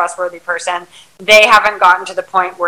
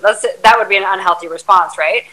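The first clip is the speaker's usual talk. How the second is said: ordinary talk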